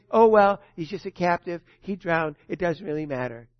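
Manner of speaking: ordinary delivery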